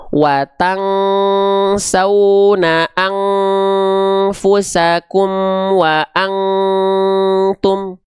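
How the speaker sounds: slow chanted recitation with a long ng in "watang", "angfusakum" and "angtum"; sustained "ang" before each following consonant; everything read as ng. Shown as a demonstration. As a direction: flatten every n into a held ng hum. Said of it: "jadi bunyi ang semua".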